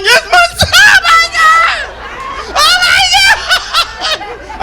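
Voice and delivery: very high, loud, drawn-out comic bawling; over-the-top sobbing shout; hammed-up wailing. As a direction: wail as if too choked up to talk. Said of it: "Couldn't even talk anymore".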